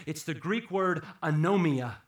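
normal talking voice

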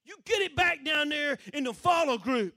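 loud, high drill-style chewing out; cussing-out shout. Retold or imitated. Commander sending recruit back down, angrily shouting. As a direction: order him back like a furious officer. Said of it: "he proceeded to cuss me".

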